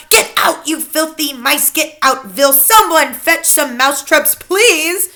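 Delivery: high, frantic teacher's outcry; shouting. A narrator's reading. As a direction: shout orders from atop the desk. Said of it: "stand upon the desks and shout".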